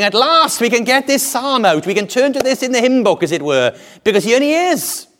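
high-pitched, elated exclamation; jubilant crowd. Acted. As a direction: voice the crowd's joy, pitched well up. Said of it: "And so they're saying".

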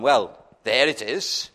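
a flat, dismissive "there it is"; casual pointing-out with no help given; offhand shrug. Pretend. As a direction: toss it off as if it's nothing. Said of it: "you don't just say to them".

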